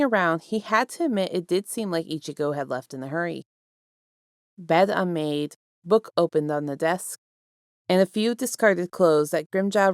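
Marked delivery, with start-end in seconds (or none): none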